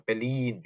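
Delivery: "벨린" in two syllables, stressed long ending; correct German pronunciation. Shown as a demonstration. Teacher asks learners to read this way, end-stressed two-syllable "벨린". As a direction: draw out the final 린 of "벨린". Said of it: "요렇게 읽어주셨으면 좋겠다".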